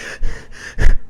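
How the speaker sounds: a loud imitated gasp for breath; mock huffing gasp. Imitating the officer huffing at her car window. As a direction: puff out air like an angry cop. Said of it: "huffing and puffing".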